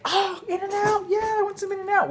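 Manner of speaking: put-on high little-girl voice begging for burgers; imitating little girl. Dad imitating daughter wanting In-N-Out, high and eager. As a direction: squeak it like an eager kid. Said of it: "Ella's like".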